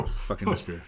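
ordinary delivery

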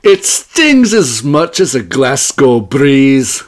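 loud, drawn-out mock Scottish; big hammed-up Glasgow burr; Scottish accent. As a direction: belt it out slowly in a put-on Scottish accent. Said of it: "get the accents rolling".

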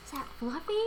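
stunned disbelief, acted. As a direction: imitate a girl daring to hope. Girl spots her missing dog.

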